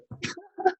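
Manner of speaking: high burst of laughter. Real, not acted. Narrator laughing at own coffee shop story, high laugh. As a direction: laugh at the absurdity.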